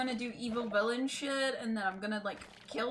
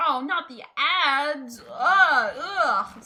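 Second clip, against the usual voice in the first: loud, drawn-out frustrated groan; 5 semitones higher, 12 dB louder, much slower than usual. Real, not acted. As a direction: groan at the ads, loud and slow. Ads interrupt stream.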